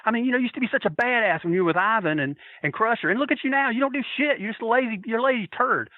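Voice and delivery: high-pitched retold rant; going nuts, heckling. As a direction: lay into him like a ringside heckler. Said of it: "I went nuts and said".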